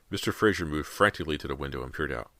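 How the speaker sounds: usual speaking voice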